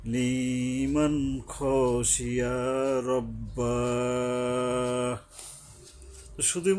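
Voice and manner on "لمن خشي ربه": slow, melodic chanted tilawa; تلاوة مجودة بطيئة; Quranic recitation. Acted. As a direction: draw out each word in measured cantillation. Quran verses chanted before lesson.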